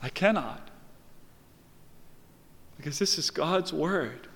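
suddenly soft and measured; grave refusal. Real, not acted. Preacher refusing to soften God's word, grave and quiet. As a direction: answer softly, with long pauses.

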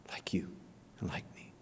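hushed, breathy whisper. Acted. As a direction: drop to a near whisper. Intimate close of the appeal.

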